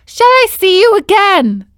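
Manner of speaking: put-on high, loud boy puppet voice; high, loud Pinocchio voice; indignant puppet. A narrator's reading. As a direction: insist you are no fish.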